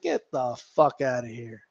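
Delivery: low, quiet scoff under the breath; muttered dismissal. Retold or imitated. Retelling his reaction, a quiet muttered scoff. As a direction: mutter it like you can't believe it. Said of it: "I'm like".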